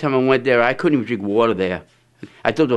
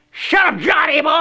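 squeaky put-down, much higher; high mock yell. Quarrel retold, shouted line.

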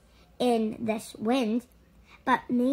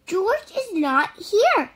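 a touch higher, alarmed; worried man; 7 semitones higher, 3 dB louder than usual. A narrator's reading. Man with the yellow hat hears the news, mildly alarmed. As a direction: sound a little worried. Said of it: "said the man".

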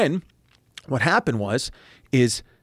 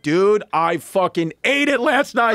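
mock shout; 6 semitones higher, slower than usual. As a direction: shout out that he bombed. Quoting his podcast opener.

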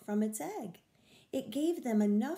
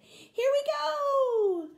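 put-on high, slow, joyful shout of a baby turtle; excited cry; 10 dB louder, much slower than usual. A narrator's reading. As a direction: cry out with glee as they slide into the sea. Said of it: "cried Tammy".

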